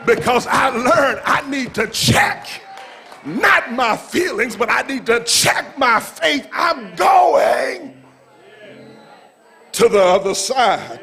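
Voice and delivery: rousing, rhythmic sermon delivery; fired-up preacher working the crowd; full-force preaching. Real, not acted. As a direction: preach it out to the congregation, driving each phrase.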